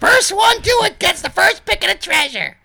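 high girl voice, hammed-up; Dina. Sister challenging her brother to a race, high girl voice. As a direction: call out the challenge in a high put-on voice. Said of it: "here, I'll do Dina".

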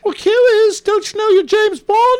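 high British character voice; Major Boothroyd. Acted. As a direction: put on a high-pitched British accent. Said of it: "I'm doing it in a British accent".

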